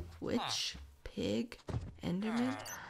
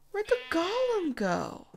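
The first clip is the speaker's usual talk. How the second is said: high, louder wail of a question; exasperated calling out; 10 semitones higher, 5 dB louder than usual. Real, not acted. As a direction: cry it out high, baffled. Calls out again for golem.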